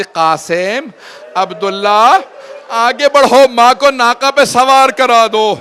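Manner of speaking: put-on loud commanding call to the young men. Husain summons the youths to help his mother mount, loud call. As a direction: call them forward so the whole camp hears.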